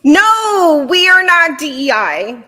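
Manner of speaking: loud, high-pitched outburst; emphatic refusal. Real, not acted. Panelist rejecting equal-representation question, loud emphatic refusal. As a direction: belt out a firm objection.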